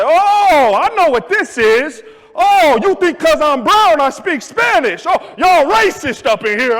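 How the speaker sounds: loud, high, indignant shouting; hammed-up angry rant; offended customer. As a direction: imitate an outraged accusation. Offended customer accuses staff of racism.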